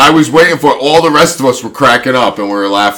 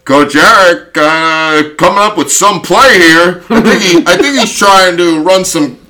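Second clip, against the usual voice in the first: play-by-play announcer, raised and loud; Harry Doyle; 6 semitones higher, 5 dB louder, slower than usual. Retold or imitated. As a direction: call it like a wisecracking sportscaster. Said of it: "He's trying to be like the guy from the Indians".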